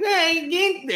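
put-on high-pitched Argentine kid outburst; Argentine accent. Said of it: "he's got his Argentine accent".

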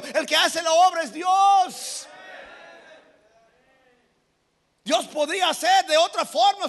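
high, forceful pulpit delivery; full-force preaching. Real, not acted. Preacher insists God does the work, full-force preaching. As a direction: thunder it out to the congregation.

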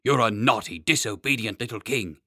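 put-on loud, stern scolding of a child; angry scolding. A narrator's reading. Chancellor shaking the boy, scolding him loudly. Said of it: "shaking, and said".

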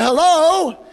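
high, loud drawn-out hello; calling out. Retold or imitated. Soul winner at a stranger's screen door, calling out. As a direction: call through the door to someone unseen.

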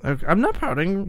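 high, pouty denial; pouty protest. Acted. Sidekick scolded on the show, pouty. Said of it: "Don't pout either".

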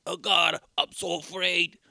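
slow, hammed-up frightened plea; mock fear. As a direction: overplay a dying man's terror. Dying villain retold.

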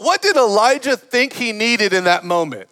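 usual speaking voice

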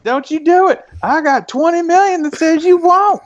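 very high, blustering mock Texan; thick Texas accent. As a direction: imitate a huffy rich Texan making a dare. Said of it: "extremely thick Texas accents".

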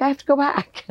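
high, longing insistence; eager self-quote. Retold or imitated. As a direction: insist you need to return. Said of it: "And now I'm like".

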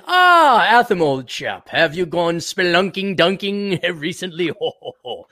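hammed-up old-chap British voice; mock British. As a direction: fake British gent, chortling at the end. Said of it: "none of us have British accents".

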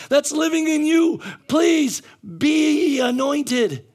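loud, high preacher's exhortation; emphatic preaching, key words drawn out; full-force preaching. Real, not acted. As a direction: urge the congregation with shouted conviction.

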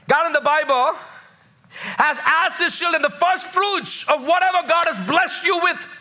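high-pitched, ringing scripture proclamation; emphatic preaching. Real, not acted. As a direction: drive each phrase home like a preacher.

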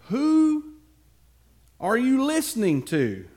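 high, loud challenge; indignant call. Acted. David challenges Saul outside the cave, loud and indignant. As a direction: demand it of the king with full force.

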